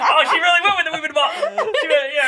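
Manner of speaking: giggling while commenting; laughing. Real, not acted. Laughing at co-host's bit.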